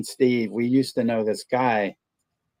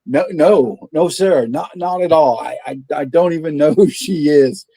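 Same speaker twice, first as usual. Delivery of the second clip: flustered clerk; 4 semitones higher, 6 dB louder than usual. Retold or imitated. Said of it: "And the guy just".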